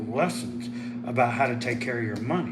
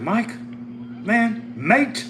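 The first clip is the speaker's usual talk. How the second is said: lightly put-on Australian accent, higher and louder; 10 semitones higher, 5 dB louder, much slower than usual. Imitating Matt's reply about lost subs, mock Australian accent. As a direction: imitate an Australian mate, pitched up. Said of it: "Australian accent".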